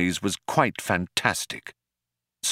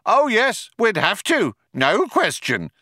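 high, emphatic agreement, easing at the end; cop calling back; 12 semitones higher than usual. A narrator's reading. Other cop agrees with his partner, calling back. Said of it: "the other one called back".

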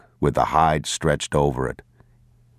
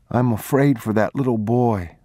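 put-on lighter, higher voice of a worried young boy; a small boy fretting aloud; boy's voice; 6 semitones higher than usual. A narrator's reading. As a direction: ask it as an anxious child.

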